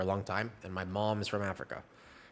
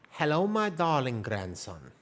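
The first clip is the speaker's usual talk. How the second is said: slow, higher South African granny voice; South African grandma accent; 4 semitones higher, slower than usual. Retold or imitated. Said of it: "my grandmother was a very thick South African accent".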